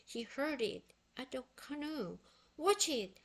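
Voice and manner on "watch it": louder, higher warning shout; sharp warning call. A narrator's reading. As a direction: yell a quick warning. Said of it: "shouted Jay".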